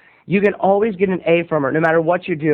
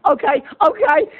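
much higher, flustered teacher; 10 semitones higher than usual. Retold or imitated. Teacher stopping the movie in a fluster, high voice. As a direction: hurry the class along, flustered. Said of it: "she's like".

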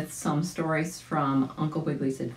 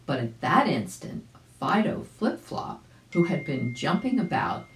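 ordinary delivery

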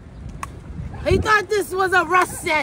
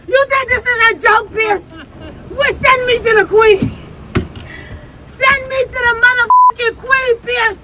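loud, higher, hammed-up tirade; mock angry rant; 4 semitones higher, 5 dB louder than usual. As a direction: rant at Piers like a furious challenger. Mock rant aimed at Piers.

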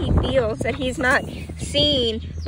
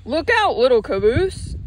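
calling out warning; 4 dB louder than usual. A narrator's reading. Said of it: "called the flat car".

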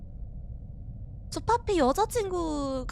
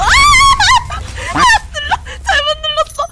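much higher, loud panicked shriek; panicked scream; 13 dB louder than usual. Real, not acted. Player hits wrong button, screams in panic.

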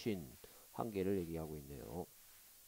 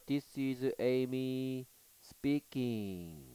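slow clear reading; 2 semitones higher, much slower than usual. Shown as a demonstration. Teacher voices Amy slowly.

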